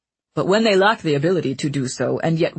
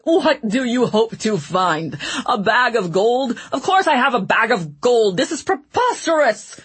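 high, loud blustering outrage; indignant protest; 6 semitones higher, 3 dB louder than usual. Acted. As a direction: bluster in rising fury. Ratcliffe protesting while seized.

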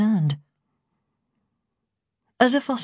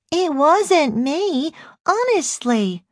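put-on very high, drawn-out toddler voice; babyish voice; 12 semitones higher, 3 dB louder, much slower than usual. A narrator's reading. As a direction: plead like a little baby, high-pitched. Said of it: "speaking in a babyish voice".

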